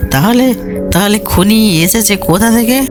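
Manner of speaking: high, stumbling question; baffled questioner. Acted. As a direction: pitch up, bewildered and pressing. Colonel baffled by the answer. (হতবাক কর্নেলের চড়া গলায় প্রশ্ন)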